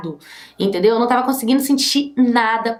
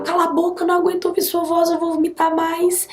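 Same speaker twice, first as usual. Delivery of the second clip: high, pinched, sick of him; mock disgusted cry of a sick girlfriend; 8 semitones higher than usual. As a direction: push him away, nauseated. Retold self, disgusted.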